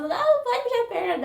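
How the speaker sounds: high, mock reassuring order; mock doctor voice. Retold talk with doctor in the room. (imitando o médico com voz bem aguda)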